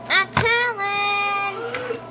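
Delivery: very drawn-out, taunting; tattling threat. Pretend. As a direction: stretch it out like a tattletale.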